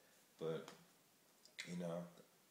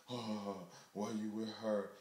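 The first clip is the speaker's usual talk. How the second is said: louder, higher mock accusation; jealous girlfriend; 4 semitones higher, 7 dB louder, slower than usual. Girlfriend confronting him over another woman, loud. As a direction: imitate the girlfriend calling him out. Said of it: "scared of what this girl is gonna say".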